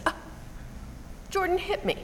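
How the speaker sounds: put-on high, whiny voice of a crying boy; lightly voiced child in tears; tearful little boy.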